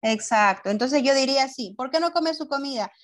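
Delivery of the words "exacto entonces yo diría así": normal talking voice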